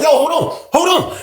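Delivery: high urgent call to stop; high-pitched "wait up" holler. Retold or imitated. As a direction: call after someone who just stormed off. Calling after him to stop him.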